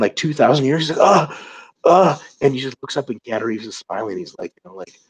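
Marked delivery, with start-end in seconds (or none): 1.0-2.2 higher, louder mock gasp of pain; hammed-up groan of real pain; imitated pained groan. Retelling the devil's first pain in centuries, mock groan. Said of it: "he feels the real pain".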